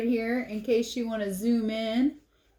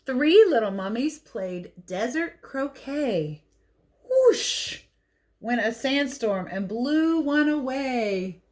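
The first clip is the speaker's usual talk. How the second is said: put-on lively read-aloud for kids; picture book reading; 4 semitones higher, slower than usual. A narrator's reading.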